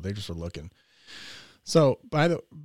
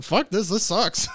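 slow, higher, louder complaint; fed-up groan; 9 semitones higher, 6 dB louder, slower than usual. Retold or imitated. Staring at the wall after the swim, slow fed-up. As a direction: drag it out, miserable. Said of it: "looked at the wall going".

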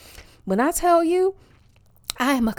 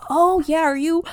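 imitated small talk; 6 semitones higher, 4 dB louder than usual.

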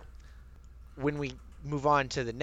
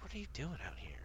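soft, higher-pitched mock puzzlement; hushed puzzled question; 5 semitones higher, 14 dB quieter, faster than usual. Host imagines meeting a Southerner up north, hushed and puzzled. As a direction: ask it under your breath, pitched up. Imagined reaction to a stranger's accent.

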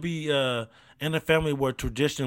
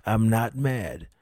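slow, lower, held-in denial; exercising self-control; 5 semitones lower, slower than usual. Real, not acted. Host on his ninth restart, exercising self-control. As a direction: repeat it slowly, keeping your temper in check. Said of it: "this is me exercising self-control".

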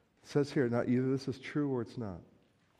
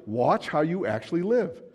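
ordinary delivery

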